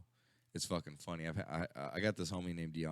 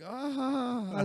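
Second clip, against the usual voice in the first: gleeful laugh; 5 dB louder than usual. Retold or imitated. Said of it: "I'm like".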